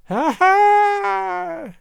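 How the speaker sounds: high, piping witch's cackle; old witch cackling at the king. A narrator's reading. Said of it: "she piped".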